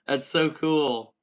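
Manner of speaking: normal talking voice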